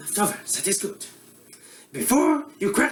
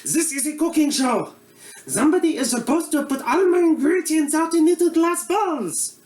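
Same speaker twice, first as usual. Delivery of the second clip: put-on comic Eastern European accent as a bumbling TV cook; broad Russian accent; mock Russian accent; 2 semitones higher than usual. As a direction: play a hammy Russian-accented chef host. Comedic Boris cooking show character.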